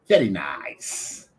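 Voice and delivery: put-on very slow vetti nice in a light Italian accent; imitating an Italian man's very nice. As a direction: quote the Italian bar owner's very nice. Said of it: "that was his accent".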